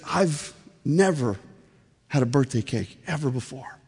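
understated imitation of someone moved to tears; tearful, retold. Agnes seeing her first birthday cake, understated tearfulness. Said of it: "she's just bawling. She's just crying".

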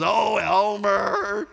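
slow, drawn-out mock scold from an old grandma; grandma's fond scolding. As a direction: stretch it out like a grandma swatting away her flirty husband. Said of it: "she goes".

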